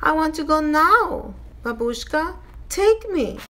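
put-on high, insistent child voice; high-pitched child begging grandmother; insistent little girl. A narrator's reading. Child demands to go in story.

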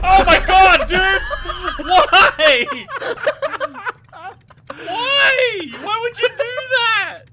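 loud, high disbelieving yell; shocked yelling. Real, not acted. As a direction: yell at your teammate in shock. Teammate grenades him.

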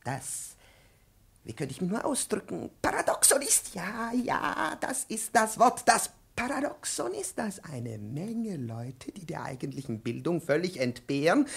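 usual speaking voice

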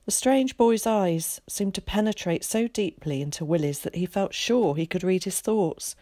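ordinary delivery

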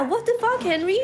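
high-pitched, quick outrage; indignant high squeal at a betrayal; indignant echo. Retold or imitated. Echoes game character's outraged line.

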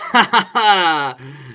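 slow hammed-up ha ha ha; slow villain laugh. Real, not acted. Vlogger laughing at her own revenge joke, slow and hammy. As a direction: cackle slowly at your own joke.

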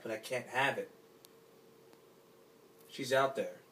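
ordinary talk

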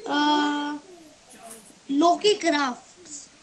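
drawn out slowly; slow and hesitant. Real, not acted. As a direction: slowly spell it out as you think. Slowly offering a word.

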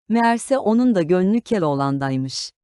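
usual speaking voice

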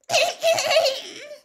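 very high, loud "hem hem"; prim, high-pitched fake cough; affected little cough. A narrator's reading. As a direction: squeak a throat-clearing to interrupt. Umbridge interrupts again with cough.

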